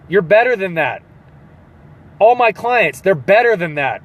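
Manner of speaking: ordinary delivery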